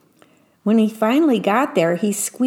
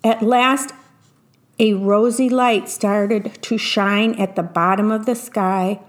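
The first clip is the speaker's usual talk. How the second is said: usual speaking voice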